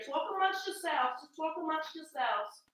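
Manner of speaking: high and distant, away from the mic; far off. Real, not acted. Telling viewers to talk amongst themselves from across the room. Walking away, talking from afar.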